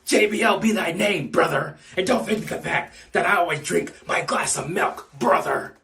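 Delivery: ordinary talk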